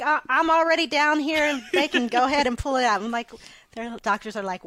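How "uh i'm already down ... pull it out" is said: louder, higher and fast, bubbling over; eager friend. Retold or imitated. Pregnant best friend offering her eggs, fast and excited.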